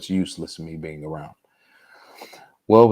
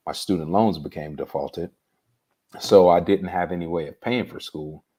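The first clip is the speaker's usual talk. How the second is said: ordinary talk, nothing marked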